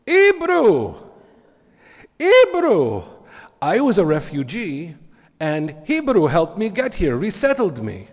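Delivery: very high, loud, excited mock Venezuelan accent; butchered Venezuelan accent. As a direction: exclaim it in a bad Venezuelan accent. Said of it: "I can't possibly do the accent right".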